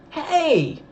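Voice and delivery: sharp high shout. A narrator's reading. Dale yells at the deputy shaking his friend, loud shout. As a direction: yell it to stop her. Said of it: "Dale shouted".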